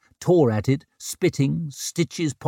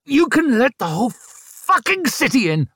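high, strained, snarling shout; furious snarl; 12 semitones higher, 4 dB louder than usual. A narrator's reading. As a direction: snarl it through labour pain. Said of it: "she snarled".

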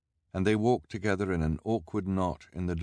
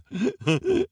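delighted squealing giggle; high giggle; 6 dB louder than usual. A narrator's reading. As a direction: giggle at your own scoop. Reporter laughs.

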